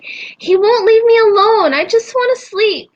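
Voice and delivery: imitating a crying little girl, high and loud; whiny, tearful child voice, slowed; upset child. Mother voicing her scared little daughter, high, tearful and whiny.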